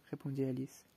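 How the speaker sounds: usual speaking voice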